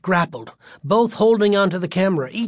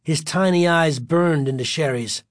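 ordinary delivery, nothing marked

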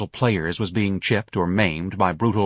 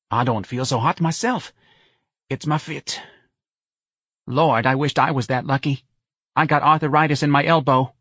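higher-pitched New Orleans mother; put-upon mother in New Orleans dialect; complaining Missus Riley; 6 semitones higher than usual. Acted. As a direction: moan about your aches to a friend.

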